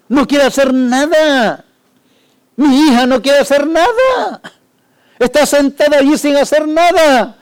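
high, loud wailing complaint; distraught parent. Pretend. Preacher voicing grieving parent, loud lament. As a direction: lament the idle children, stretch the last nada. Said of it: "llorando de tristeza".

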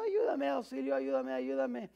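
restrained retold yell for help; mock cries for help. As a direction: act out a woman's plea without really shouting. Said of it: "una señora que estaba gritando, gritando, gritando".

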